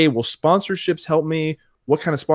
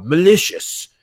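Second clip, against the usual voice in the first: proper English; 6 semitones higher, 3 dB louder, slower than usual. Retold or imitated. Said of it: "saying it in a very proper".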